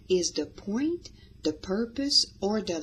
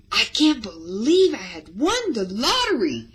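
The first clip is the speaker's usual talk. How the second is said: louder, higher burst of disbelief; excited; 4 semitones higher, 7 dB louder than usual. Shown as a demonstration. Teacher showing podcast storytellers an excited exclamation. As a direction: sell the joy of a lottery win.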